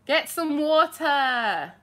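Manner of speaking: loud, drawn-out London accent. Shown as a demonstration. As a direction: call it out high and slow in London speech. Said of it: "like, my London accent".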